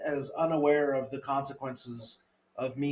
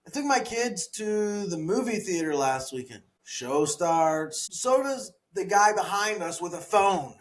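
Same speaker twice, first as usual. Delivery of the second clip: bright, higher comic storytelling, climbing as it goes; pitched-up stand-up bit, fast at the tag line; stand-up joke delivery; 7 semitones higher than usual. Acted. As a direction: sell the joke to a crowd, building to the punchline.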